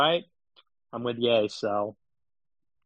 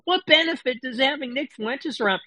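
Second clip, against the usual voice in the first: mock Crowder; 10 semitones higher than usual. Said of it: "and then he goes".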